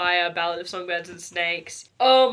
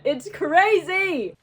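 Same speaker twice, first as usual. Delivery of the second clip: high, drawn-out wail of disbelief; slow, soaring mock-despairing cry; exasperated outburst; 10 semitones higher, 6 dB louder, much slower than usual. Real, not acted. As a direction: stretch it out in overwhelmed disbelief. Overwhelmed outburst about the book.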